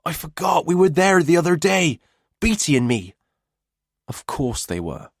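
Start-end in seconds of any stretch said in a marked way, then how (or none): 0.0-3.1 lightly voiced excited teen; bright boyish voice, a touch high; eager young lad. A narrator's reading. Tate's voice in narration.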